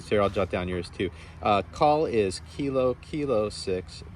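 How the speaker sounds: ordinary talk, nothing marked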